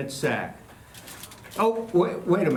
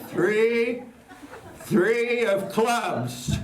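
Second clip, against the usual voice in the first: dramatic reveal; 5 semitones higher, much slower than usual. Acted. Magician's triumphant card reveal.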